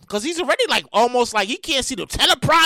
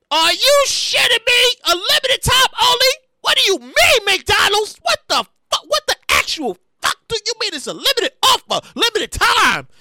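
outraged disbelief; 4 semitones higher than usual. Real, not acted. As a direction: demand answers, unable to believe it. Outrage at limited time.

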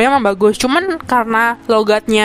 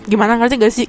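normal talking voice

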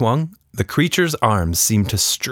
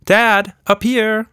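higher, louder call; girl calling down; 13 semitones higher, 5 dB louder, slower than usual. A narrator's reading. Tilda calling to her dad from the tree, loud call.

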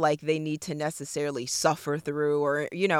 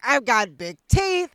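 louder mock whine; imitating friend; 5 semitones higher, 6 dB louder than usual. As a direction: ham up the friend's complaint. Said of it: "She's like".

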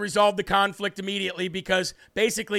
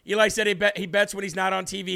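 ordinary talk, nothing marked

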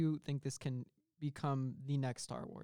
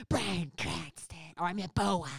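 slow, loud monster voice; hammed-up boa constrictor voice; demon snake; 4 semitones higher, 5 dB louder, slower than usual. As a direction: rasp it out slowly as a snake creature.

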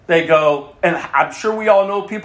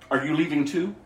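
low, soft, searching question; quiet question; 7 semitones lower, 7 dB quieter, faster than usual. Acted. Jesus asking the twelve as others leave, quiet question. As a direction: ask it gently, lower and softer.

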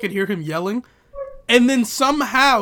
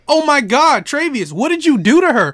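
raised, accusing chorus of classmates; 6 semitones higher, 3 dB louder than usual. Retold or imitated. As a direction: pile on him like shocked classmates, stretching the crying question. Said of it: "the whole class was like".

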